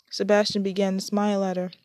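usual speaking voice

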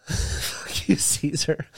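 hushed, breathy jab; a laughing, whispered dig at Caesar; mock whisper. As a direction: whisper the insult like a secret.

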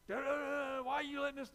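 high, loud, put-on complaining voice; whiny complaint. Preacher mimicking himself blaming God, high and whiny. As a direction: wail at God like a frustrated believer.

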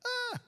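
sudden high wailing cry; high anguished yelp for the plant; Jonah's cry. Acted. Jonah mourning his dead plant, high cry.

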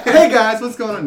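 high, loud hyped YouTuber greeting. Pretend. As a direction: pump it up like a channel intro. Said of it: "Coming through with the YouTuber voice".

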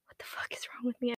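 hushed, unvoiced self-reproach; whisper. Real, not acted. Vlogger catching herself, whispering in embarrassment.

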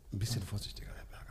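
real soft whisper; hushed aside. Real, not acted. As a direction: murmur it so the mic barely catches it. Aside while touching the microphone.

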